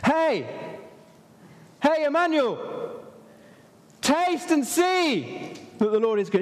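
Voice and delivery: high-pitched calling out, stretched vowels; acted shout. Preacher as David getting our attention, acted high shout. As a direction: call out as if hailing someone far off. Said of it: "It's like he's shouting".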